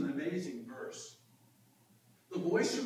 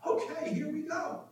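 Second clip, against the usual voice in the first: high, eager acted exclamation; excited Peter; 10 semitones higher than usual. Preacher imagines Peter thrilled by the scene, excited. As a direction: burst out like an excited disciple. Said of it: "in his excitement was like".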